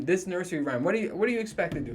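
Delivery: usual speaking voice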